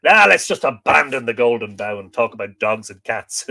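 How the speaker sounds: loud, joking declaration; mock exasperation. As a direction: pretend to throw it all in, loudly. Jokingly pretends to quit the reading.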